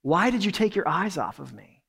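tender Jesus, lovingly asking why; loving, compassionate. Retold or imitated. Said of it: "in a loving and compassionate tone".

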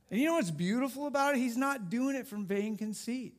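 normal talking voice

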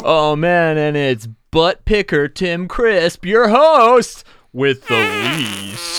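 loud, high announcer intro; hype announcer. Acted. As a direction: sell the intro like a game show. Hyped mock host introduction.

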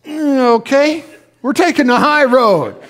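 high, loud imitation of a spouse giving in; mock grudging agreement. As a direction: give in with a high, drawn-in "mm, okay". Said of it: "the other one will go".

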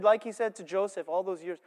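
normal talking voice

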